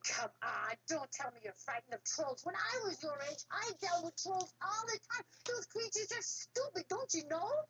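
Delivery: put-on rising, scornful old father; mock them like a proud old man; scoffing father. A narrator's reading.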